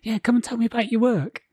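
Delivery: high-pitched mocking policeman voice; imitating a mean officer, pitched well up; mock mean copper. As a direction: taunt like a copper making an arrest. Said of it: "it was just so mean of the police".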